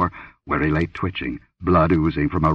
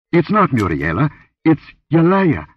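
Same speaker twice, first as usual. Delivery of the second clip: higher, louder, dawning horror; shocked realization; 9 semitones higher, 4 dB louder than usual. Acted. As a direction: realize with a jolt who it is. Horrified realization over the body.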